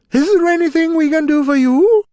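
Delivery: quick eager mouse offer; squeaky helpfulness. A narrator's reading. Big mouse offers help.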